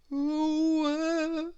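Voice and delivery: high, lightly wailing ooh; a hammed-up little wail; mock wail. A narrator's reading. Mister Dizzy, lost in the dark, in a mock wail.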